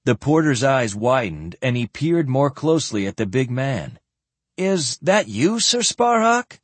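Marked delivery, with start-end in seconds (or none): 4.6-6.6 high, slow amazed question; incredulous. A narrator's reading. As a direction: ask in disbelief. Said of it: "he asked incredulously".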